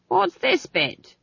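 high, slow, querulous interruption; old witch. A narrator's reading. Old witch interrupts the play again.

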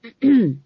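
pointed loud ahem; a deliberate ahem; meaningful throat clearing. A narrator's reading. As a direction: clear the throat to get attention. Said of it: "cleared her throat meaningfully".

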